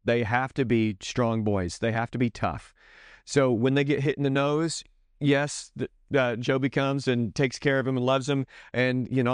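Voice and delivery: usual speaking voice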